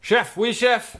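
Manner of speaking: loud, eager soldier yell; gung-ho soldier. Pretend. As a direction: bark it like a drill recruit. Mock military reply to order.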